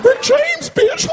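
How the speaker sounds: squeaky, very high cartoon shout. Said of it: "Even Mickey Mouse did it".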